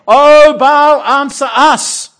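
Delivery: high, very loud, slow frantic cry; a frenzied prayer yelled at full force; frenzied shouting. Pretend. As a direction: shout over and over to Baal. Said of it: "Shouting out over and over".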